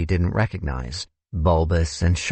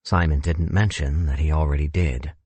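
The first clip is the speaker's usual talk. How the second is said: usual speaking voice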